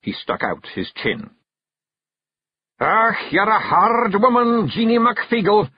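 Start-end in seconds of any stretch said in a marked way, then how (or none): 2.8-5.7 high, loud Scots grumble of surrender; giving in to his wife; defeated Feegle. Acted. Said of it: "he said at last".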